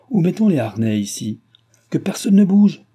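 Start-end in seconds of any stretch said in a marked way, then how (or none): 1.9-2.8 high, loud command; shouted order. A narrator's reading. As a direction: shout it to stop everyone. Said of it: "cria le maître de poste".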